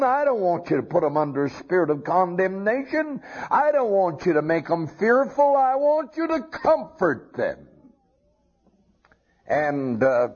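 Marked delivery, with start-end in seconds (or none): 0.0-7.7 rising plea. Acted. Preacher voicing God's charge.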